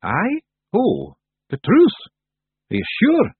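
high, hesitant Feagle questions; rather nervous. A narrator's reading. Rob doubts Tiffany wants the truth, nervously. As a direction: venture it slowly, pitch climbing with unease. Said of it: "Rob ventured rather nervously".

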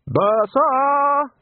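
loud, high shout across the beach; yelling. A narrator's reading. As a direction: yell the name out to sea. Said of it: "Ridcully yelled".

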